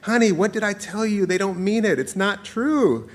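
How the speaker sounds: high, gentle mom voice; acted motherly reassurance, pitched high; consoling mother. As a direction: soothe the child, drawing out the ending. Mother consoles the crying child.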